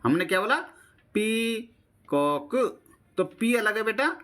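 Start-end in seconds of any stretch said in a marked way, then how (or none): 1.1-2.5 peacock in two slow, separate beats; teacher breaking peacock into its syllables. Shown as a demonstration. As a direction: stretch पी high, pause, then कॉक. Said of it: "ये अलग syllable है और ये अलग है".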